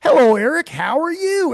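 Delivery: loud, high, hammed-up wrestling catchphrase; wrestling greeting. Host greeting Eric for his wrestling fans, hammed-up shout. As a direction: belt out a mock wrestling hello. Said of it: "do this for all my wrestling fans".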